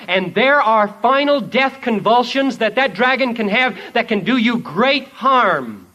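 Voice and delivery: loud, high, urgent pulpit warning; full-force preaching. Acted. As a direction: thunder out the warning, hammering the key words.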